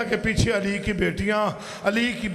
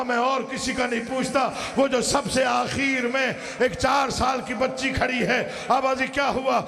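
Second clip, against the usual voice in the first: impassioned majlis preaching; 4 semitones higher than usual. Acted. Preacher telling of the exhausted child in Yazid's court, impassioned lament. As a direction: build the grief, stretching key phrases.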